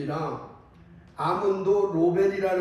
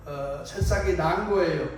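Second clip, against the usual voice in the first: ordinary talk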